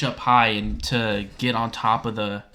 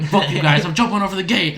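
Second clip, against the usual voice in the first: loud, frantic declaration; freaking out; 5 semitones higher, 5 dB louder than usual. Retold or imitated. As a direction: blurt it out in a panic. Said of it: "freaking out".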